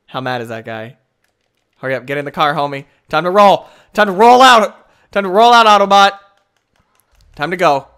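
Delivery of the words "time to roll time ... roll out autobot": loud heroic announcer boom, hammed up; hammed-up Optimus Prime. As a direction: belt it out like a big robot hero. Quoting Optimus Prime catchphrase.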